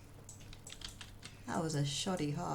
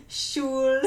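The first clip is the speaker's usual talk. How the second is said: high giggling bursts around a word; laughing; 7 semitones higher, 6 dB louder than usual. Real, not acted. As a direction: break up laughing mid-word. Laughing mid-question at stream.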